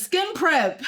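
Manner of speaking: gleeful vindicated quote; triumphant quote. Retold or imitated. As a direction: crow the commenter's words back. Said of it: "One person says".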